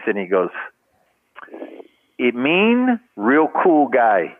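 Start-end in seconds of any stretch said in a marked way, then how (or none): 2.2-4.4 slow, sly, smiling delivery; devious sly grin. Retold or imitated. Miranda teasing the rookie, slow sly grin. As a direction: let the grin show, drawn out. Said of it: "this devious little smile comes on Miranda's face".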